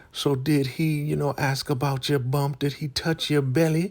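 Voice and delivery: high, prying elder voice; pry in a high put-on voice; Elder Mother Marva. Elder asks Ruth about her bump, high nosy voice. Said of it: "Elder Mother Marva's like".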